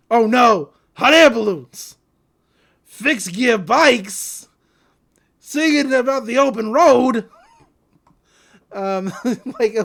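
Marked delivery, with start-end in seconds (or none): 0.1-7.3 loud, hammed-up alarmed outburst; retelling a cartoon scene with a loud mock panic. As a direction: shout the list like a cartoon character spotting disaster.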